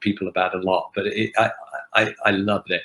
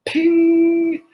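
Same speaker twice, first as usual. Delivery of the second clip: elevator ping; 4 dB louder than usual. Retold or imitated. Elevator doors open.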